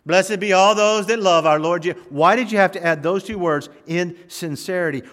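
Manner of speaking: loud, high-pitched complaint; mock protest. Preacher playfully challenging Apostle Paul over two words, loud protest. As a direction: plead your objection loudly, in pretend dismay.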